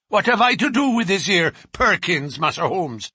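rattled thug swearing he was elsewhere; protesting bruiser. A narrator's reading. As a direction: plead innocence loudly. Bruiser denies the killing.

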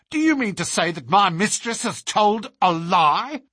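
high, outraged challenge; indignant cry. A narrator's reading. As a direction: demand it loudly in defence of your mistress. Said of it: "cried Teresa".

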